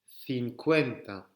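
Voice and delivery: Cincuenta said slowly, thinkwenta; slow model. Shown as a demonstration. Teacher modelling Cincuenta slowly. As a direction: stretch out Cincuenta syllable by syllable. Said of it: "Allez-y pour celui-là".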